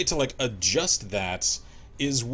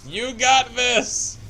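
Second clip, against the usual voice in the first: overacted cheering; 12 semitones higher, 10 dB louder, slower than usual. Cheering on teammate in game.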